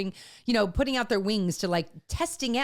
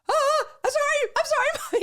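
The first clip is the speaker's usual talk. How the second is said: higher, hurried startled sorry; startled apology; 5 dB louder than usual. Retold or imitated. Son apologizing to his upset mom, startled. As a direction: blurt it like a shocked son backpedaling. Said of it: "and he was like".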